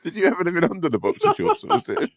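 high, laughing question. Real, not acted. Friend laughing while asking about the boxers. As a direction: ask while cracking up.

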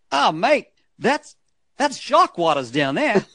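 high, put-on Aussie voice; Australian accent. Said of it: "that's my Australian accent".

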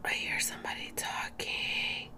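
hushed aside, very soft; whisper. Real, not acted. Vlogger overhears somebody talking, whispering. Breaks off, hears someone talking.

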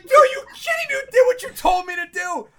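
very high, loud shriek of disbelief; livid shout. Retold or imitated. Golfer blaming his caddy for the lost ball, livid. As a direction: explode in high-pitched comic outrage. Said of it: "I was so livid".

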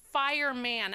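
fireman as "fire-MAN", full short a; full MAN ending. Shown as a demonstration. Host questions who says this, fireman with full "man". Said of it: "They say policeman".

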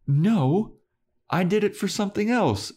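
high-pitched retold concession; mock admission. Spike answers Giles's leading question, mock admission. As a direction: act out being led into an admission.

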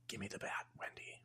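breathy menacing whisper; husband whispering a demand for the bat; whispered threat. Retold or imitated. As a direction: whisper it like a creeping predator. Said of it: "He's doing different voices".

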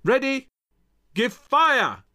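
high, loud artillery order; yelled command. A narrator's reading. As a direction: yell the order to the gunners. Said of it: "Hamilton yelled".

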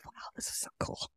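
fast, breathless whisper of admiration; awed whisper. Retold or imitated. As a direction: whisper it like a secret thrill. Retelling her own admiring reaction.

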